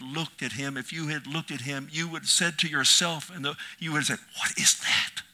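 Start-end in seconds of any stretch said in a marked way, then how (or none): 4.4-5.2 awed whisper. Acted. Imagined onlooker at the cross.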